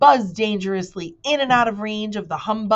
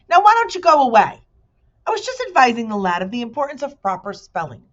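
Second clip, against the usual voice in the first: much higher, louder and fast; irritated bee; 7 semitones higher, 7 dB louder, faster than usual. Acted. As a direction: shoo him off impatiently. Bee shoos the humbug.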